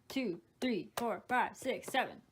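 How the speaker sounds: ordinary talk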